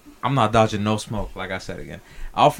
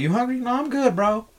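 high, breezy mock reply; casual denial; 11 semitones higher than usual. Explaining his anger logic, a high breezy mock reply. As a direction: brush it off lightly. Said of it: "I'm gonna be like".

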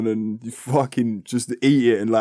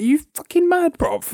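1 shocked disbelief. Retold or imitated. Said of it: "I was like".

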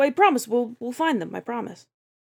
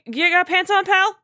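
higher, louder call across room; 11 semitones higher, 4 dB louder than usual. Acted. Player calling to Hans to get dressed, loud casual call.

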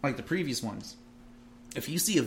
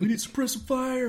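high, loud squad shout; hammed-up battlefield order; military callout; 12 semitones higher, 5 dB louder than usual. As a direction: call for cover like a soldier. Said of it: "using actual terminology on each other".

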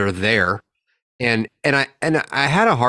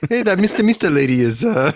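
high squeaky laughing mister; 10 semitones higher, faster than usual. Pretend. Laughing mock of word.

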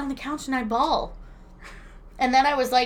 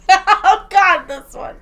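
ordinary talk, nothing marked

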